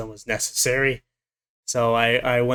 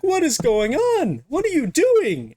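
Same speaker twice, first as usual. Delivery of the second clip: much higher, slow, startled mock companion; alarmed companion; 13 semitones higher than usual. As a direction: play the shocked friend bursting in. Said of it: "He's like".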